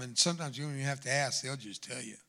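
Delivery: ordinary talk, nothing marked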